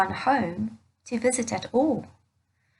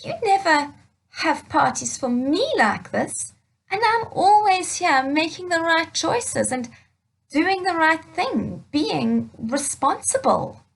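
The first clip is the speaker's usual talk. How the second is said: higher, aggrieved son pleading his case; a hurt complaint to his dad; upset older son; 6 semitones higher, 4 dB louder than usual. Acted. Older son complaining to his father, mildly upset. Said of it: "was a little bit upset".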